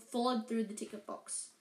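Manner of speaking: plain reading voice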